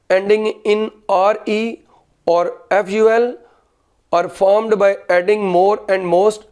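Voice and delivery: slow, deliberate dictation pace. Shown as a demonstration. Teacher dictating a grammar rule to students, slowly. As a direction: spell it out slowly so students can write. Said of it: "आपने लिखना है".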